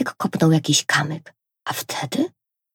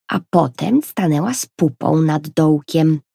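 plain reading voice

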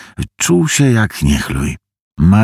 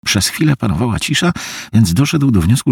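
ordinary delivery, nothing marked

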